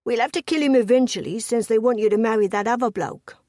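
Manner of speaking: loud Cockney thug; forceful Cockney villain's voice; thick Cockney accent. A narrator's reading. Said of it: "thick with a Cockney accent".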